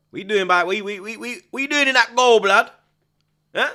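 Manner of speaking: loud, high incredulous yell at the screen; shouting at the keeper. Real, not acted. Football fan shouting at the goalkeeper on TV, loud and incredulous. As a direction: bellow it in disbelief.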